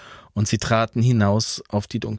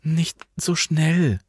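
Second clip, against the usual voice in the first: higher, slow, puffing for air; winded plea; 8 semitones higher, slower than usual. A narrator's reading. Alva, out of breath, begging Franz to slow down. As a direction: beg to slow down while panting. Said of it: "begann Alva zu schnaufen".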